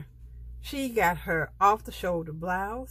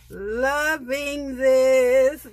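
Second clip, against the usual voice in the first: very slow, stretched, louder and higher; drawn-out delight; 5 semitones higher, 8 dB louder, much slower than usual. Real, not acted. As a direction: savor each word in delight. Repeats phrase, drawn out and loud.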